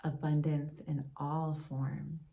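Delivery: calm, unhurried meditation voice with long pauses; guided meditation. Acted. Yoga teacher leading a gratitude meditation for listeners, guided meditation. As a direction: gently guide them inward, leaving space between phrases.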